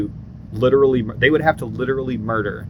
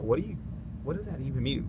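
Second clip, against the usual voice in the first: much softer, puzzled under the breath; confused murmur; 3 semitones higher, 12 dB quieter, faster than usual. Retold or imitated. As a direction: mutter it to the guy beside you. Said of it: "we were like".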